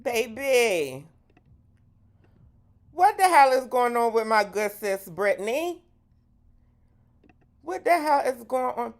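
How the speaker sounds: high, loud incredulous outburst; exasperated disbelief. Real, not acted. Host reacting to Brittany's clip, high exasperated disbelief.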